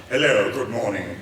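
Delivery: mock English lord accent; put-on aristocratic English greeting; upper-class English accent. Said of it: "they all sounded like Charles Dance".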